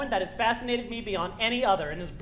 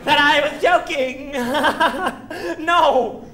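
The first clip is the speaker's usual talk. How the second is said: laughing; 3 semitones higher, 8 dB louder, slower than usual. Real, not acted. Laughing at the absurd thought.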